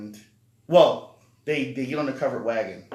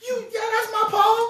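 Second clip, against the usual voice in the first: imitating girl; 6 dB louder than usual. Girl playing along.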